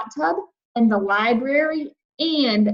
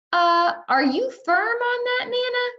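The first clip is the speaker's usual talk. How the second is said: high timid asking; 13 semitones higher than usual. A narrator's reading. Junie asks Nana timidly.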